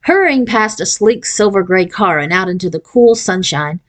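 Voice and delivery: ordinary delivery, nothing marked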